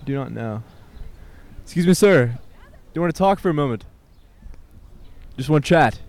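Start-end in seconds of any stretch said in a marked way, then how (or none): 1.6-6.0 much higher and louder, hailing a man passing by; high, loud call. Real, not acted. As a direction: hail him high and loud. Calling out to a passerby.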